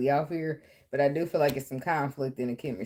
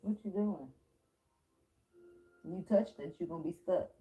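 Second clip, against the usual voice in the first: higher, pet-talk warning; cooing caution to her cat; 5 semitones higher, 6 dB quieter than usual. Real, not acted. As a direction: gently scold the kitty.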